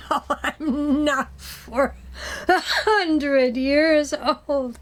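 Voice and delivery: laughing through the words, slow; giggling no, slow and amused; laughing denial. Real, not acted. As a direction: laugh while protesting.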